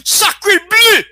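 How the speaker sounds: high, loud hammed-up French cry; French: exclamation théâtrale; mock French exclamation. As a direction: shout it like a cartoon Frenchman. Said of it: "best accent in the entire sophomore class".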